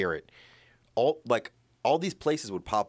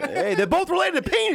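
high, loud gleeful squeal; excited; 6 dB louder than usual. Real, not acted. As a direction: squeal it out triumphantly. Gleeful segue joke.

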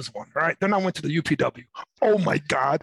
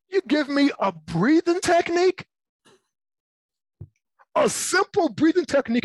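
incredulous; 9 semitones higher than usual. Real, not acted. Incredulous rant about therapy.

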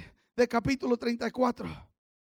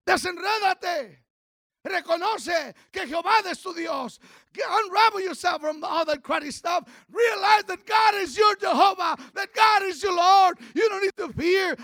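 preaching climax; 5 semitones higher than usual. Acted. Pastor urging the church to trust God, full-force preaching. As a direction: lift the congregation with it.

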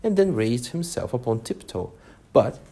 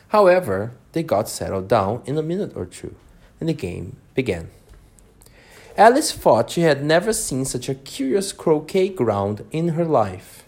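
plain reading voice

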